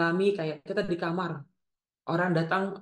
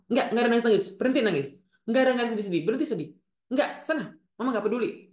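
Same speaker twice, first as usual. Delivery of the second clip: higher-pitched, brusque scolding; cold, uncaring mother; 5 semitones higher, 3 dB louder, faster than usual. Acted. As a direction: act out a mother forbidding tears. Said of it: "Ibu ini dingin sekali". (ibu dingin melarang anak menangis)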